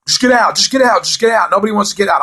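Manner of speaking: high, rapid-fire repeated urging; panicked urging. Retold or imitated. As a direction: act out everyone pushing each other to go. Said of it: "Everyone's telling each other to get out".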